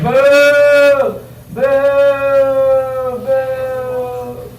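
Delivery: long, high drawn-out boo; imitated booing. Comic retelling a girl heckling his set, drawn-out booing. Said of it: "starts booing me for no reason".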